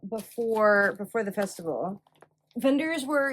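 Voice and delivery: ordinary delivery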